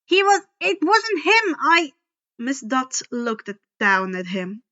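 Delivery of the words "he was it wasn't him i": high, loud, tripping over words; nervous boy stammering; panicked stammer. A narrator's reading. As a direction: scramble to defend a friend. Grover stammering defence.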